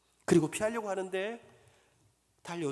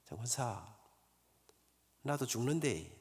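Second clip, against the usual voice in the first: much softer, lower and resolute; soft but firm; 9 semitones lower, 6 dB quieter, slower than usual. Retold or imitated. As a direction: say it small but firm to your wife. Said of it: "작지만 단호한 목소리로".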